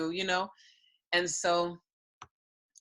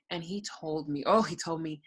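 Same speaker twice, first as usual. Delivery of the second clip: ordinary talk, nothing marked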